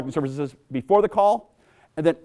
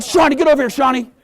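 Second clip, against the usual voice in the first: higher, louder call; dad calling coach Shawnee over, loud; 10 semitones higher, 6 dB louder than usual. Retold or imitated.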